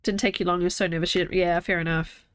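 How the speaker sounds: ordinary talk, nothing marked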